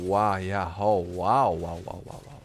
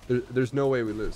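ordinary talk, nothing marked

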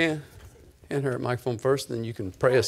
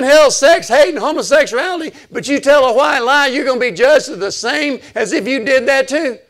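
high, loud, insistent retelling; heated preacher making his case; full-force preaching; 15 dB louder than usual. Real, not acted. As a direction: argue the point hard from the pulpit.

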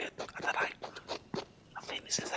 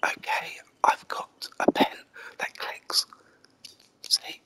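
ASMR whisper; 4 dB louder than usual. Pretend. Gamer whispering ASMR over the game. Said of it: "and I'm doing A S M R".

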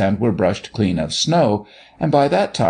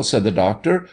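plain reading voice

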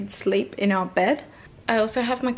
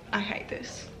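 muttered quote; 8 dB quieter, slower than usual. Retold or imitated. Quoting her own thought.

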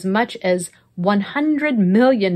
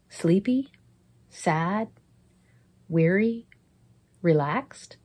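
guided prayer; 3 dB quieter than usual. Acted. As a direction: lead them gently into prayer.

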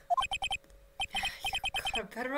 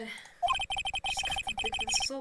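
high inner voice. Acted. Reads character's panicked inner thoughts.

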